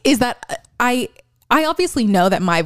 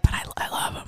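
guilty little whisper; whispered confession; 9 dB quieter than usual. Retold or imitated. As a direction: whisper it like a secret. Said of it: "I'm just like".

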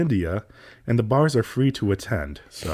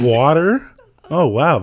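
louder, higher, hammed-up disbelief at a cheap order; mock surprise; 5 semitones higher, 7 dB louder, slower than usual. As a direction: act amazed that he only wants water. Server mocks a water order.

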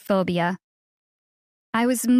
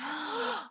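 quick, soft, high intake of breath; sharp little acted gasp; startled gasp; 9 semitones higher, 14 dB quieter than usual. A narrator's reading. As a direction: gasp as if suddenly alert. Gasp on opening eyes.

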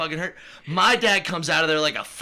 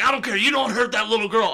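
louder, higher scolding; stern dad; 6 semitones higher, 4 dB louder than usual. Retold or imitated. Retelling dad defending the little girl, louder. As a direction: scold like a protective grandpa. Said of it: "He's like".

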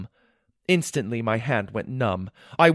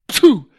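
sharp, high puff like a dart firing; blowpipe shot sound; 10 semitones higher, 11 dB louder than usual. A narrator's reading. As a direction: imitate a blowpipe going off.

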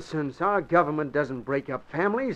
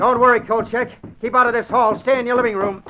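urgent orders; 7 semitones higher, 4 dB louder than usual. Acted. Reporter rushes off after the crook.

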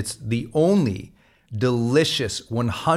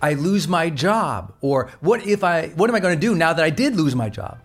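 anxious voice, pitched up, pleading; rising anxious what-if questions, slightly raised; worried questioner; 6 semitones higher than usual. Retold or imitated. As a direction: voice the panicked listener asking what to do. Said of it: "I've been getting that question constantly".

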